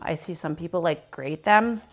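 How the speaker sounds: usual speaking voice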